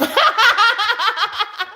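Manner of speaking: bursts into a high laugh. Real, not acted. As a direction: laugh at your own joke. Laughs at own angel voice.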